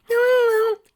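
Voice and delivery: put-on tiny high defiant child voice; stubborn filly. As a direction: talk back like a stubborn kid. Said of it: "Dinky argued back".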